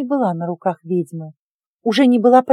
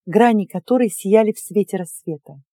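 ordinary delivery

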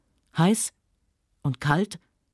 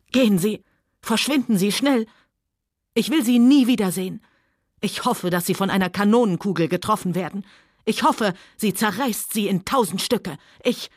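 angry shouting; 3 semitones higher than usual. Acted. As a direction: shout him away. Scarlett drives Rhett away.

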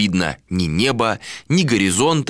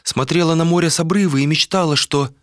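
higher female character voice, dreaming; wistful woman; 9 semitones higher than usual. A narrator's reading. Woman tells captain her sea dream, wistful higher voice. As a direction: confide the dream in a raised pitch.

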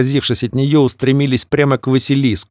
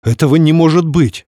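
louder, higher astonished cry; exclaiming in disbelief; 6 semitones higher, 4 dB louder than usual. Acted. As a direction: burst out, unable to believe it. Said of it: "воскликнул он".

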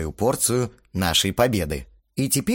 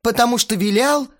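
angry shout; 12 semitones higher, 5 dB louder than usual. Acted. Band members quarrel, shouting.

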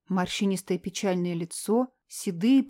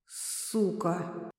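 quiet rasp; 3 semitones lower, 7 dB quieter than usual. Acted. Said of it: "в тихом хрипе".

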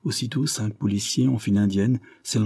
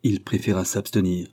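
plain reading voice

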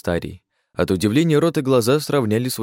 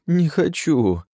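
whiny refusal; 8 semitones higher, much slower than usual. A narrator's reading. Whining refusal again.

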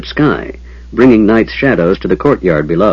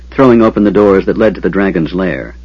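usual speaking voice

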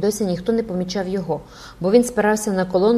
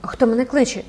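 higher, as if just woken; startled exclamation; 5 semitones higher than usual. A narrator's reading. Jupiter answers the stranger's shout, startled and higher-pitched. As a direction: jolt awake with a question. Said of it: "вигукнув Юпітер, мов би щойно прокинувся".

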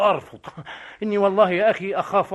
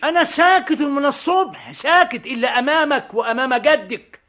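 high, loud indignant protest; exasperated retort; 7 semitones higher, 3 dB louder than usual. Acted. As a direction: answer back in exasperation. Quarrel with the arrogant policeman. (محتجًّا بضيق وبصوت عالٍ)

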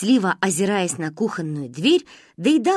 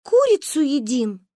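put-on meek high-pitched girls, slow; high guilty girls; 6 semitones higher, 3 dB louder than usual. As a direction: answer like caught children.

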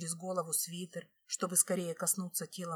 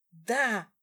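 loud, higher fire-back; raised, emphatic yes; defiant retort; 4 semitones higher, 6 dB louder than usual. A narrator's reading. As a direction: throw it straight back at him, louder. Defiant answer to husband's roar.

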